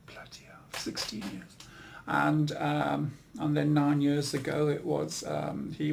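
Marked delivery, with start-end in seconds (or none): 0.1-1.6 whispered disbelief; stunned whisper. Real, not acted. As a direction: breathe it out under your breath. Shock at the years passed.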